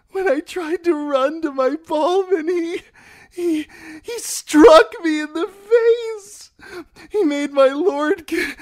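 very high, loud, halting sobs; weeping. A narrator's reading. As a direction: cry it out, catching on words. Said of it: "Stop that weeping".

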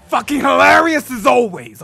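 loud, high sarcastic jab; irritated outburst. Acted. Character snaps at a joker.

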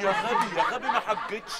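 loud high laughing "يا غبي"; exasperated yell. Acted. Dola yelling at Ramzi that he never went on hajj. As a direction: shout him down, half laughing.

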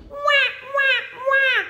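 put-on very high loud baby wail; high baby cry wah sung loud. Shown as a demonstration. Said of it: "or like a baby".

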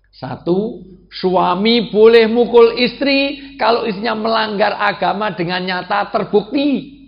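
high, loud preacher's proclamation; forceful preaching. Real, not acted. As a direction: hammer the rule home to the congregation.

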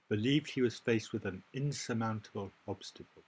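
ordinary talk

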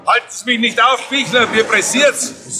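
high, urgent call, in a rush to get through; hurried shout. Acted. Wanninger shouting at the checkpoint officer to let him through, hurried and high. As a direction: shout it out, no time to stop.

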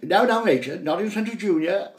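hammed-up prim reproach; mock scolding husband. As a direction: hush your wife in front of the boy. Father hushes mother.

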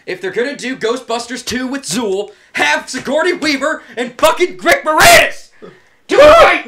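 building rant. Real, not acted. Fan demanding a proper Ghostbusters sequel, shouting. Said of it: "rant building".

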